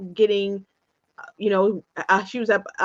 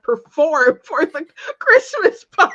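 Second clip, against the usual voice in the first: high, loud voice breaking into laughter; laughing while talking; 13 semitones higher, 6 dB louder than usual. Real, not acted. Recalling singing for the Christmas party, laughing hard. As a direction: crack up through the words.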